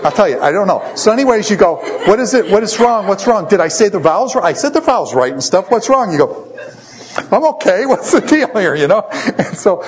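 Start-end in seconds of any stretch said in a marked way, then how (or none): 2.1-6.1 high, rapid-fire panicked questions; hammed-up frantic new husband; mock freaking out. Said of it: "you're freaking out". 7.3-8.7 high, comic flustered outburst; hammed-up panicked husband; mock freaking out. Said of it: "you're freaking out".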